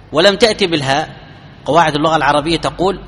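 normal talking voice

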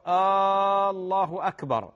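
آه، الله أكبر with a long added questioning Aa before الله, drawn out; chanted آه، الله أكبر turned into a question by the long Aa; wrong questioning Allahu akbar. Shown as a demonstration. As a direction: stretch آه into a questioning Aa then الله أكبر, demonstrating the error. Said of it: "هذا خطأ، هذا استفهام".

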